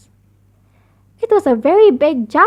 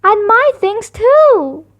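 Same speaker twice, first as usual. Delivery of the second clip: crying out; 6 semitones higher, 3 dB louder, much slower than usual. A narrator's reading. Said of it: "cried Brother".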